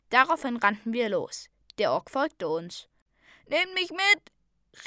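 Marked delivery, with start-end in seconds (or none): 3.5-4.8 high, drawn-out plea; calling out. A narrator's reading. Orc begging to come along, high drawn-out call. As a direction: call after them across the battle. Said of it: "rief er durch das Schlachtgetümmel".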